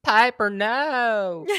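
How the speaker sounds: loud, very slow Southern drawl; Southern accent impression. Friend demonstrating which accent she means, exaggerated Southern drawl. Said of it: "Not like a Southern, like".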